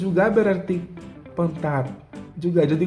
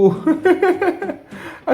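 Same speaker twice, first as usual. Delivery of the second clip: high laugh; 14 semitones higher than usual. Real, not acted. Laughing at the joke.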